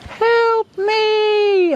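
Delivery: very high, thin squeak of a voice; squeaky high-pitched plea; tiny fly voice. Retold or imitated. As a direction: imitate the tiny trapped fly pleading. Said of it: "and I'd hear, long pause".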